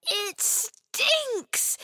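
high-pitched disgusted complaint; high girlish disgust. A narrator's reading. Disgusted by the bin smell.

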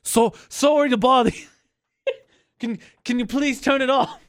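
high, put-on polite British accent; apologetic caricature in a wobbly British accent. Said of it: "I don't know why I'm in a British accent".

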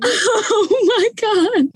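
high, drawn-out laughing exclamation; laughing disbelief. Retold or imitated. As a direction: stretch it out high through laughter. Reacting to being thrown out, laughing.

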